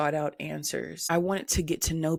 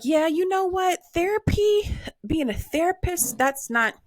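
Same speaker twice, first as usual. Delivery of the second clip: high decided outburst; 12 semitones higher than usual. Retold or imitated. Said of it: "So I was like".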